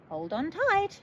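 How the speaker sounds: high, loud call; shouting. A narrator's reading. Gus warning the girls at liftoff, loud shout. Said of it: "shouted Gus".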